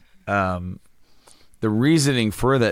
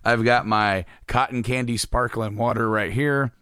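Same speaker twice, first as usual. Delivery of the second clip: usual speaking voice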